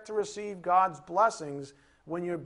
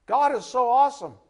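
joyful quote; 6 semitones higher, 8 dB louder than usual. Acted. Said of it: "The very opening statement is".

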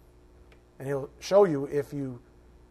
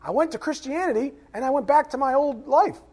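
higher, louder, breezy mock churchgoer; smug churchgoer; 10 semitones higher, 8 dB louder than usual. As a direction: parody the one-and-done believer. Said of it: "You don't come in here".

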